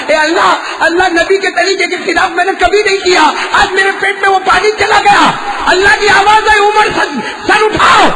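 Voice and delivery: high, loud sermon shout; impassioned preacher at a high pitch; full-force preaching. Acted. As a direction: thunder it out to the crowd.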